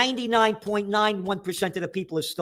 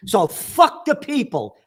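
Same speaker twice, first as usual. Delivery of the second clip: sarcastic mock outrage; 6 semitones higher, 4 dB louder, slower than usual. Mocking the central bank's stance.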